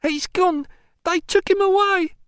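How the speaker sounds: high-pitched grieving cry; distraught wail. Acted. Mother telling visitor her son was taken, distraught.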